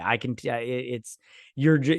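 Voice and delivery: ordinary talk, nothing marked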